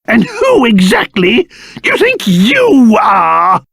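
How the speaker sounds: ordinary talk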